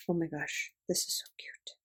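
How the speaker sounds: soft, unvoiced whisper of delight; hushed, whispered gushing; awed whisper. Real, not acted. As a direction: whisper it as if overcome by cuteness. Hushed delight at favorite item.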